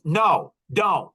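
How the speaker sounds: loud, emphatic retold refusal; very clear refusal. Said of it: "he was very clear".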